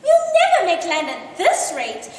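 high, loud shrill cry; shrill it at the slaves. A narrator's reading. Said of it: "she shrilled".

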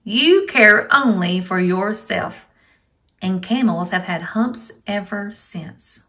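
loud, lively storybook reading; reading to a toddler. Acted. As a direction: read aloud to small children. Teacher reads story to kids.

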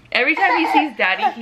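ordinary talk

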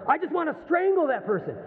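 mock furious outburst; imitating anger. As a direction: voice someone fuming, pitched well up. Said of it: "so filled with anger".

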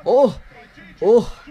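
high, loud oh; excited shouts. Real, not acted. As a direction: shout it out in surprise. Watching a big punch.

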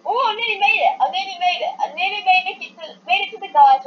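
frustrated excitement. Real, not acted. Near miss in game.

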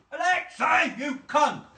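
man cursing the speaker at full volume; angry shout. Real, not acted. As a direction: spit it at the gadget. Yelling at smart speaker.

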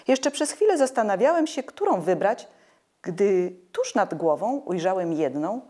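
plain reading voice

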